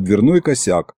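plain reading voice

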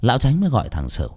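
usual speaking voice

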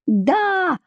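high-pitched furious cry, without much volume; acted yell, pitch jumping up; beside herself, yelling. A narrator's reading. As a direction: cry it out in exasperation. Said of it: "уже вне себя заорала Эмма".

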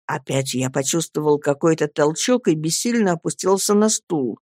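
usual speaking voice

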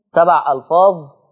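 normal talking voice

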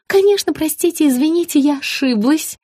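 high rapid apology; blurted in one breath. A narrator's reading. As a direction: rush the apology out. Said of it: "на одном дыхании выпалила Рита".

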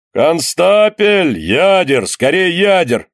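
high, long-drawn battle call; urgent calling out. A narrator's reading. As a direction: holler for ammunition across the deck.

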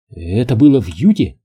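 ordinary delivery

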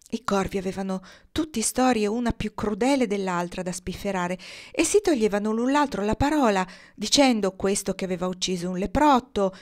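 usual speaking voice

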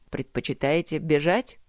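plain reading voice

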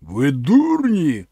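loud, slow snarl; furious Cimmerian scolding the tribesmen, loud and slow; angry snarl. A narrator's reading. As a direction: bite down hard on "дурні". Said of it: "гарикнув розлючений кімерієць".

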